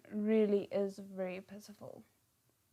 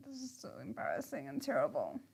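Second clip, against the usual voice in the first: soft, low, embarrassed; low embarrassed murmur; cringing mutter; 6 semitones lower, 9 dB quieter, faster than usual. Real, not acted. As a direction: mumble it into your hands. Cringing at the scene.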